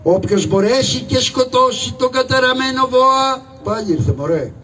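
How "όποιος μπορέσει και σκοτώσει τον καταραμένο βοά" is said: raised, ringing proclamation over the music; town crier's call. Acted. Crier announces the reward to the town, calling out. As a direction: cry it out to the whole town. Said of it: "να βγω να τελαλήσω".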